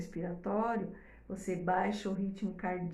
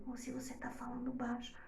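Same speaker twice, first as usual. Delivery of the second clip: much softer, near hushed; speaking softly; 12 dB quieter than usual. Shown as a demonstration. Teacher demonstrating a soft voice to mothers, quietly. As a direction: drop the voice right down as a demo. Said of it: "ela vai entender o tom de voz".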